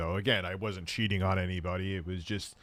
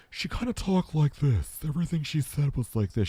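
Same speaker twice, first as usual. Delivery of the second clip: higher, put-on odd voice; strange woman's voice; 5 semitones higher than usual. Man demonstrating how a woman he slept with talked, strange voice. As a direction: imitate a woman with an odd way of talking. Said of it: "she had a very strange voice".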